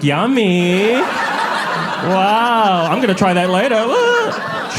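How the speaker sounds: high, mock-enthusiastic audience member; overly polite fake delight, ending in a whoop. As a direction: gush high with put-on open-mindedness.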